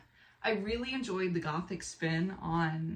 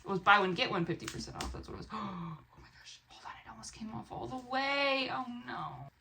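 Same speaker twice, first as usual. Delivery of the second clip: usual speaking voice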